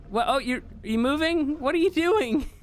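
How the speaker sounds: exasperated, high scolding; incredulous scolding. Real, not acted. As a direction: scold the pet in disbelief. Pet ignores his whistle command.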